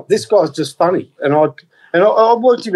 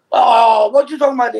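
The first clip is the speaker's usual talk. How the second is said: high, exasperated mock Chinese accent; 8 semitones higher than usual. Shopkeeper scolding a customer after ringing up, high Chinese accent. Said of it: "doing my Chinese accent last week".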